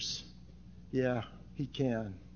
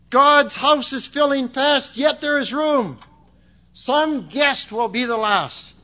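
slow, ringing, higher proclamation; forceful verse recitation; 11 semitones higher, 11 dB louder than usual. Real, not acted. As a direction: declaim each line slowly from the pulpit.

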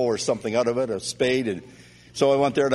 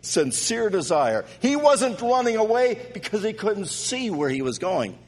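preaching; 7 semitones higher, slower than usual. Acted. Preacher telling of Bartimaeus crying out, building preaching. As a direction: hammer each line home from the pulpit.

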